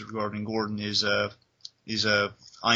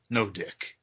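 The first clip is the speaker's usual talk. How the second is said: stern Batman; 3 semitones lower, slower than usual. Retold or imitated. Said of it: "he just gets there and says".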